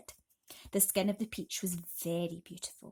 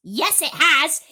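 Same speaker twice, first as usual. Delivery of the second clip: bickering aunts; 7 semitones higher, 12 dB louder than usual. A narrator's reading.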